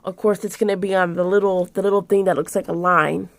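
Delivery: usual speaking voice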